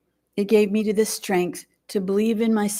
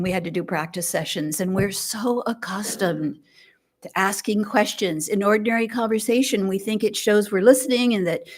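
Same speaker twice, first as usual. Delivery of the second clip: ordinary talk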